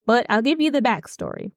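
ordinary talk, nothing marked

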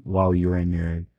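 usual speaking voice

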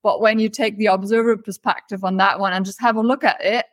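usual speaking voice

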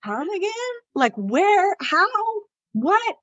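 high, incredulous questions with pauses; baffled. Retold or imitated. Mom reacting to the military wives theory, baffled. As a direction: act out being stumped, voice lifting high.

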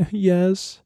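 high, teary mock whimper; imitating crying. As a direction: squeak it out like a guilty crying kid. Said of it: "So I start crying".

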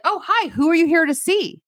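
a lightly voiced receptionist; chirpy receptionist. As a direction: receptionist greeting Barbie, chirpy. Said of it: "the receptionist's like".

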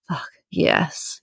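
half-groaned, a little under the breath. A narrator's reading. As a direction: groan it quietly in pleasure. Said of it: "he groans again".

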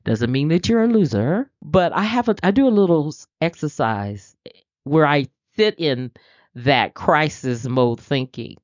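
normal talking voice

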